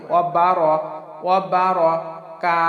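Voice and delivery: ওয়া বার- ending in thick, rounded "ro"; heavy ra. Shown as a demonstration. Teacher drills the fix, ওয়া বার- with heavy ra. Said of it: "মোটা করে".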